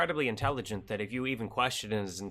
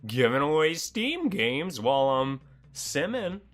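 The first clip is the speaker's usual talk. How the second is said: hype streamer; 5 semitones higher, 4 dB louder than usual. Pretend. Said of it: "be like".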